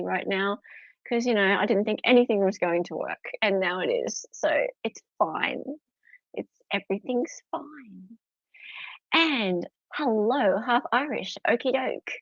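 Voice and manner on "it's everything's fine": whisper. Acted. Whispered repeat of the catchphrase.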